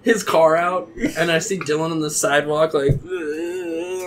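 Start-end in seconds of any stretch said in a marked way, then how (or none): 3.0-4.0 higher, softer retching groan; mimicked sick groan. Drunk friend sick on the sidewalk, groaning. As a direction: groan as if about to vomit.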